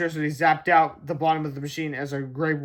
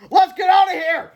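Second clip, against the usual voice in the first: high, loud panicked yell; frantic cry; 14 semitones higher, 10 dB louder than usual. A narrator's reading. George crying out to Harold as they flee, loud and high. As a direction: shout it as you flee. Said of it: "he cried".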